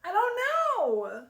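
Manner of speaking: high, loud whine; whiny high unsure. Real, not acted. Unsure about haircut.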